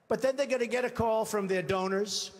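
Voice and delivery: usual speaking voice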